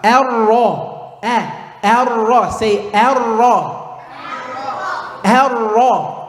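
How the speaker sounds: Ar-Ra with a light, open a; a clean light a in Ar-Ra. Shown as a demonstration. Teacher drills the light a for the class. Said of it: "we wanna say what?".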